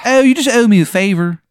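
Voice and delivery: high, loud, hammed-up Maryland accent; overdone Maryland accent, loud and high; terrible Maryland accent. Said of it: "His accent is so bad".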